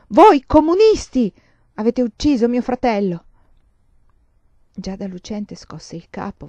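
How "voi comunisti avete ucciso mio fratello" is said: loud, high, furious accusation; fuori controllo. A narrator's reading. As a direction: shout it out having lost all control. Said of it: "il soldato perse il controllo". (urlo di rabbia incontrollata)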